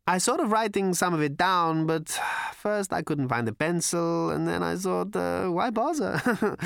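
high character voice, tickled and offhand; manic, careless Prack. A narrator's reading. Prack explains why he wrote nothing down, high and offhand. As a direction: give up on the thought, slowing at the end.

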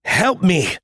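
high, strained cry for help; urgent shout. A narrator's reading. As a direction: yell it while straining. Soldier straining against the machine.

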